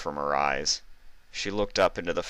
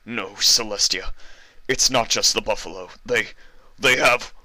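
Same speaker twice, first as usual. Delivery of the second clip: frightened stammer; 5 semitones higher, 4 dB louder than usual. Acted.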